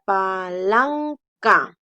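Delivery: palanca drawn out, "pa-LAN-ca"; teacher's demo of palanca, long stressed "lan"; sounded-out syllables. Shown as a demonstration. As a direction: stretch palanca, leaning on "lan".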